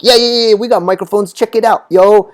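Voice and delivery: high, excited hype-man clerk; hammed-up gangster sales pitch; mock gangster. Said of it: "suddenly became very gangster".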